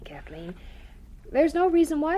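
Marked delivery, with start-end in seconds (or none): 0.0-0.5 whisper. Acted. Mother gently hushing at family evening.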